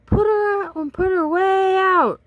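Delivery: high, loud, exasperated yell; hammed-up angry kid shout. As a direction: shout like a fed-up teammate. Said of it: "The Tornadoes were very angry".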